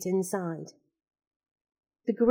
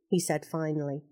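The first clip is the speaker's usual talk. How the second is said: ordinary delivery, nothing marked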